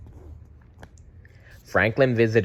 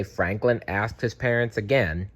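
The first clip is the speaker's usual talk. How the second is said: usual speaking voice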